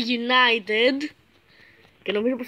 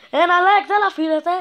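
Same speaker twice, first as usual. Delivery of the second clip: raised, louder mock grievance at viewers; loud mock complaint; 6 semitones higher, 6 dB louder than usual. Real, not acted. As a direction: call out the viewers for no likes. (δυνατό παράπονο στους θεατές)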